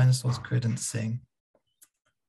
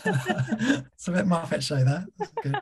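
laughing through the words; giggling remark; 9 semitones higher than usual. Real, not acted. Laughing at the rumble.

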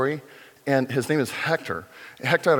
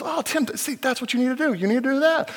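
high, delighted praise; excited praise; 12 semitones higher, faster than usual. Retold or imitated. Pastor imitates Hector praising the young painter, high and delighted. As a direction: gush with pride over the painting. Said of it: "he goes".

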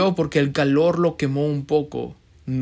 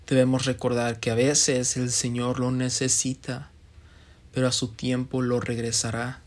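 a softer, lower preaching voice for the lesson; soft closing sermon; 4 semitones lower, 4 dB quieter than usual. Acted. A preacher closing his sermon to the church, soft and lower. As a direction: draw the flock in gently toward hope.

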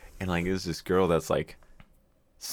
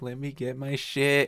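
slow, higher imitated insistence; mock exasperated demand; 7 semitones higher, slower than usual. Boyfriend wanting his stuff, mildly hammed-up exasperated demand. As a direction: draw it out like a fed-up boyfriend.

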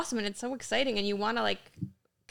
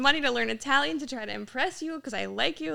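retold flustered protest, pitched up; mortified; 5 semitones higher, 4 dB louder than usual. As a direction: imitate someone embarrassed pleading their case.

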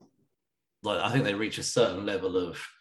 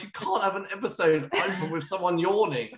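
higher, half-laughing disbelief; laughing exasperation; 10 semitones higher than usual. Retold or imitated. Retold frustration, laughing.

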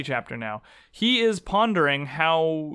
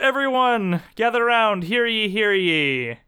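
loud town crier call, slowing down; stern archaic proclamation; 6 semitones higher, 4 dB louder than usual. Pretend. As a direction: announce it like a herald. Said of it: "I would like to introduce a very important segment".

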